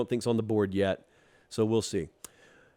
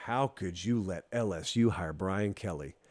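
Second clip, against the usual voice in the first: low, hushed, ominous question; quiet, deep-pitched reproach; grave disbelief; 6 semitones lower, 7 dB quieter than usual. Acted. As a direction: drop soft and low, as a serious warning. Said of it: "And I was saying".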